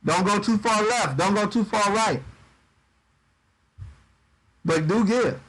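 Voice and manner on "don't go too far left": usual speaking voice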